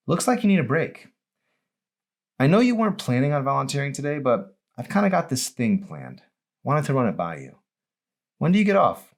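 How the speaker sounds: put-on a young woman pitching a plan; Emery's eager voice.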